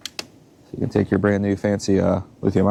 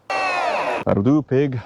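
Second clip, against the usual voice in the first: Babe farmer quote; 7 semitones higher, much slower than usual. Pretend. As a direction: coax it like a sheepdog.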